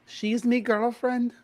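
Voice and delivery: lightly voiced British accent; put-on British accent. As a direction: say it like a cheeky Brit. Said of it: "I like that accent".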